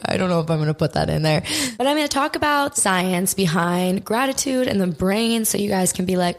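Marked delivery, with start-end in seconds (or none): 0.1-1.4 quick aside. Real, not acted. Editing aside.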